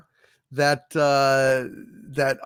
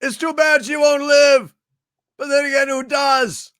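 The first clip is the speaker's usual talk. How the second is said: movie quote; 10 semitones higher than usual. Acted. Quoting movie lines for a viewer.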